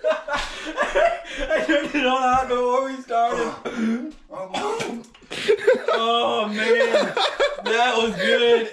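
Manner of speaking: high, slow laughing through the burn. Real, not acted. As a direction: laugh while your mouth burns. Laughing through pepper pain.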